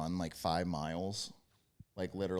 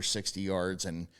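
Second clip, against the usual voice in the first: normal talking voice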